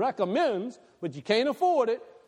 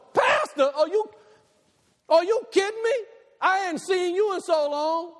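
much higher and a bit louder, gushing; mock overexcited greeting; 11 semitones higher, 3 dB louder than usual. Hammed-up excited greeting, much higher. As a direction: pastor hamming up a gushing reunion with a church member. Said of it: "And I go".

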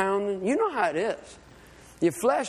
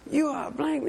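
higher-pitched mock scolding, no real shouting; retold cussing-out; 6 semitones higher than usual. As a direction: needle them like an irate rival boater. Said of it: "He's saying all kind of cussing words".

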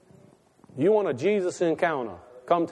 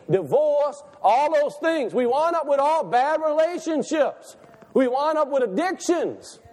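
preaching at full force; 7 semitones higher than usual. Acted. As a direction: build the sermon to a soaring high cry.

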